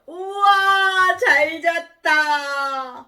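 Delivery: loud, very drawn-out waking stretch; high, slow, refreshed cry; happy waking. Acted. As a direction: stretch every vowel like a big morning yawn. Acted waking from deep sleep.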